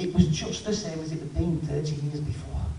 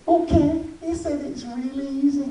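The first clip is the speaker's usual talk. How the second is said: little grandson; 12 semitones higher than usual. Acted. Grandson explains babies.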